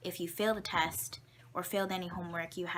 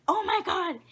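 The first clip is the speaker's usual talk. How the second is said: much higher, louder gasp of shock; shocked teacher; 12 semitones higher, 8 dB louder than usual. Retold or imitated. Teacher sees the student's broken arm, shocked high and loud. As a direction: imitate the teacher's horrified reaction. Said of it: "And she's like".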